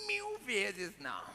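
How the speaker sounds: slow, stretched-out mock refusal; hammed-up, slow-motion denial; drawn-out refusal. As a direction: drag every word out theatrically. Hammed-up imagined audience refusal.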